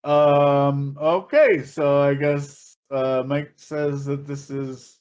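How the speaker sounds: slow, uncertain announcement; hesitant DJ. Retold or imitated. DJ resuming after brother intervenes, slow and hesitant. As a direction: imitate a baffled DJ feeling for words. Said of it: "he's like".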